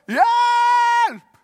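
put-on high, loud child's cry of help; shouting for help. Boy stuck on the garage roof screaming for help. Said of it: "Og jeg roper".

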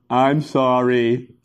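low, very slow grudging apology; insincere apology. Acted. Child told to mean it this time, insincere drawn-out apology. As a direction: drag it out like an unwilling kid. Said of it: "He doesn't mean it".

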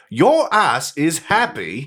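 slow, drawn-out mock Dutch accent; Dutch Goldmember voice. Retelling Goldmember's insult, slow mock accent. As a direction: stretch each word like a silly foreign villain. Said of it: "He's like".

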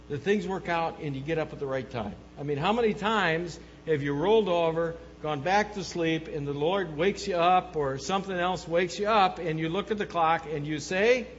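ordinary talk, nothing marked

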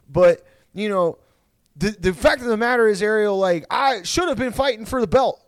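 high-pitched, aggrieved fighter impression; Nick. Fighter complaining to an interviewer, high and aggrieved. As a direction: air the grievances, pitched high and insistent. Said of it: "that Nick was like".

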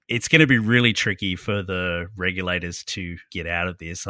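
ordinary talk

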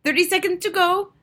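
high, loud witch countdown; excited witch announcing; crying out. A narrator's reading. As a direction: call out the countdown at a high pitch. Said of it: "cried the Grand High Witch".

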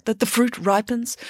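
ordinary delivery

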